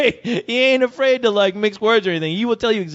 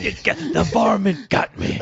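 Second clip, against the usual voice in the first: Yosemite Sam. Retold or imitated. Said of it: "Yosemite Sam does".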